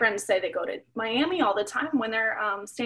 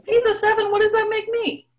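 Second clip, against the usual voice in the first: high, loud disbelief; indignant surprise; 10 semitones higher, 5 dB louder than usual. Acted. Granddaughter hears her father rated a seven, loud and incredulous. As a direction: jump up in pitch, outraged at the rating.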